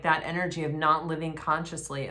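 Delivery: normal talking voice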